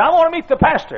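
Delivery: put-on high, insistent old-man squeak; squeaky old voice. Old preacher asking a young man for the pastor, squeaky. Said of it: "In a squeaky old voice".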